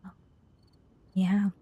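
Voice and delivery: ordinary talk, nothing marked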